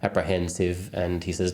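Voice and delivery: plain reading voice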